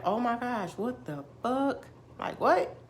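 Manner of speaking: mock disbelief; retold shock. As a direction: act out being stunned and baffled. Retold shock at right guesses.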